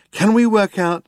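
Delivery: high, keen questioning; inquisitive Zaphod. A narrator's reading. Zaphod asks Trillian to calculate the improbability, keen. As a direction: pitch it up, working it through.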